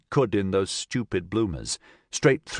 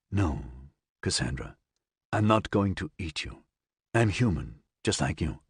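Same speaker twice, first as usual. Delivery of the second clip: soothing; 4 semitones lower, 4 dB quieter than usual. Acted. Nigel soothes terrified woman.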